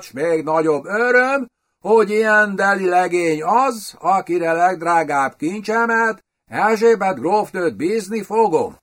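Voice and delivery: rekedt, kényeskedő idegen. A narrator's reading. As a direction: speak high and mincing, with a hoarse foreign edge. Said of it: "feltűnően rekedt hangon, idegenszerű, kényeskedő kiejtéssel". (rekedt, idegenszerű, kényeskedő kiejtés)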